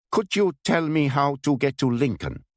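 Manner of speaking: put-on a German agent asking the way, heavily accented. Wet German stranger asking a local woman for directions, strong German accent. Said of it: "He had a strong German accent".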